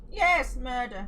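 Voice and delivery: loud, gleeful yes, murder; gleeful shout. Pretend. Player cheering the game's order to kill, gleeful. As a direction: relish the order with mock glee.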